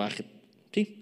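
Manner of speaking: ordinary delivery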